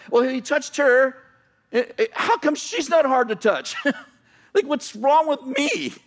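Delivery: high, drawn-out comic protest; mock indignant complaint. Acting out jealous self-justifying complaint.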